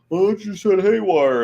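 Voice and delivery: mock customer. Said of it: "It's like, no".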